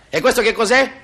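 spring the question on the student, pitched right up; sharply lifted, testing question; insistent professor. Acted.

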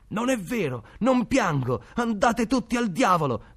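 put-on high, loud, furious child's protest; a boy yelling denial at everyone; angry shouting boy. A narrator's reading. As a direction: Pascaleddu shouts back at the taunting boys. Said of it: "gridò Pascaleddu".

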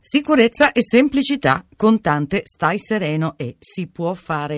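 loud, emphatic slogan delivery; punchy slogan read. Acted.

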